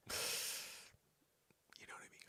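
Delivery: soft, slow hushed aside; conspiratorial whisper. Acted. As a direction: lean in and whisper it.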